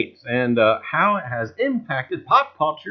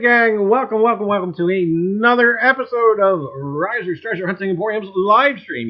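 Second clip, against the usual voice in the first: loud, higher show-opener welcome; hyped host intro; 5 semitones higher, 7 dB louder than usual. Acted. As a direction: open the stream like a game-show host. Livestream host's opening greeting.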